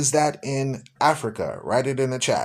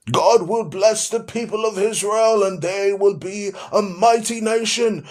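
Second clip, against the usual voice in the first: John Hagee; 6 semitones higher than usual. Retold or imitated. Mimicking a preacher appealing for donations, grandly proclaiming. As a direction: imitate a televangelist proclaiming with stretched-out words. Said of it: "That's how he talks".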